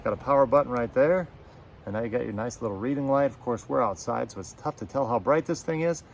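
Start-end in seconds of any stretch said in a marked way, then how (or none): none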